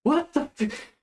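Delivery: high-pitched startled reaction; mock bafflement. Dwarves reacting to the approaching party, high bafflement. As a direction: blurt it in baffled surprise. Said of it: "they're just like".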